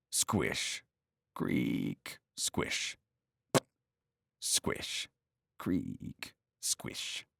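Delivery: soft breathy squish and creak; hushed sound effects for rotting boards; whispered footstep noises. Acted. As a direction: voice the soggy planks under your feet. Acting out footsteps on planks.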